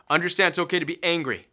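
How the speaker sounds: ordinary talk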